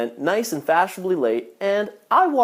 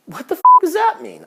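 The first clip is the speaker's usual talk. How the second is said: baffled outburst; 10 semitones higher, 7 dB louder than usual. Retold or imitated. His baffled reaction.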